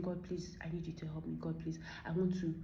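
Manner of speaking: quietly imitating a plea to God; soft retold prayer. Retelling her own prayer to God.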